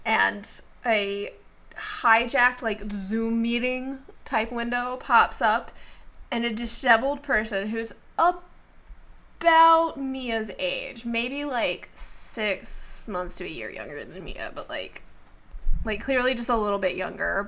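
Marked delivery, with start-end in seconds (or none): none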